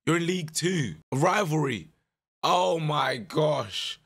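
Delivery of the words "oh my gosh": stretched, slow oh my gosh; drawn-out disbelief. Real, not acted. Manager groaning at the rival's transfer, stretched disbelief. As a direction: let the disbelief drag out.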